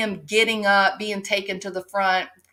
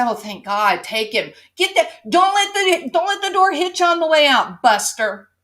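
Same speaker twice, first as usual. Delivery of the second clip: higher, louder mock sneer at him; sarcastic good riddance; 6 semitones higher, 4 dB louder than usual. Wife sneering at her departing man, mock sarcastic. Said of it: "this poor traumatized woman is gonna say".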